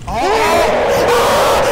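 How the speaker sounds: loud, high scream of real fright; terrified scream. Real, not acted. Player hit by a horror game jump scare, real terrified scream. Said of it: "genuine fear in my face".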